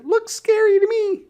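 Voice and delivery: put-on very high, loud little girl; little sister voice. A narrator's reading. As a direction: squeak it like a small stubborn girl. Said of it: "said Sister".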